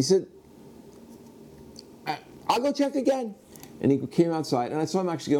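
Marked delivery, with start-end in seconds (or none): 2.1-3.3 very high, strained voice; flustered shop owner. Retold or imitated. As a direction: reluctantly give in, pitched way up.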